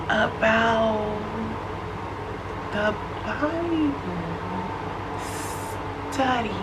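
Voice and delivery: drawn-out hushed imitation of the friend; slow soft friend imitation. Friend insisting on the Bible study, slow hushed imitation. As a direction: stretch it out softly like her. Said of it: "This is how she talked".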